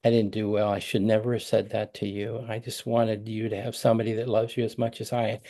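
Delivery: soft, choked-up confession to his daughter; tearful apology. Retold or imitated. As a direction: let the tears creep into the voice. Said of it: "with tears in my voice".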